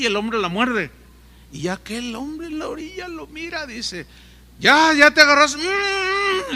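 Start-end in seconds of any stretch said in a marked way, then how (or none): 4.6-5.6 call down. Retold or imitated. Rescuer calls down to fallen man. 5.6-6.6 high hum through clenched teeth; muffled high hum with mouth closed. Retold or imitated. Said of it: "decía".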